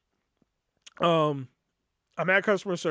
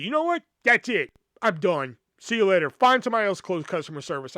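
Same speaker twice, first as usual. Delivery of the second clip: got loud; 2 semitones higher, 4 dB louder than usual. Retold or imitated. To the coworker who just insulted him, a loud outburst. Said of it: "I got loud with it".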